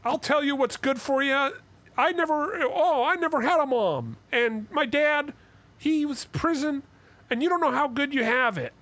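Jordan Peterson. Retold or imitated. Actor losing it on his other self, hammed-up impression. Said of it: "he's doing a Jordan Peterson impression".

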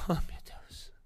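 breathy, slow "oh my God" under a laugh; touched little whisper to himself; amazed whisper. Real, not acted. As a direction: whisper it, caught off guard. Mother appears on the call.

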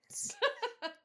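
bursting out laughing; a high squealing laugh. Real, not acted. Laughing at own quote.

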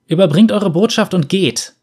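put-on defiant child. A narrator's reading. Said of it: "sagte das Kind".

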